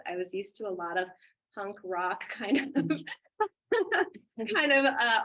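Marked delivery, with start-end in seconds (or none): 1.5-5.2 laughing loudly mid-sentence. Real, not acted. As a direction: laugh through the words. Laughing at punk rock past.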